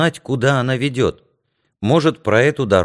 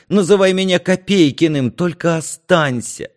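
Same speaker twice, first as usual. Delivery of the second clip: high, urgent begging, building louder; desperate pleading; 7 semitones higher, 4 dB louder than usual. A narrator's reading. Narrator begs Юрка to stay in Старогорск, pleading desperately. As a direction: plead breathlessly for them not to go.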